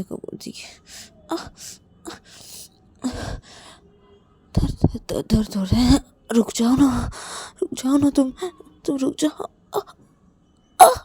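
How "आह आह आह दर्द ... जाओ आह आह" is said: high, loud pained moaning and pleading. Acted. Payal begs Ananya to stop, crying out in pain. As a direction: cry out in pain, begging her to stop.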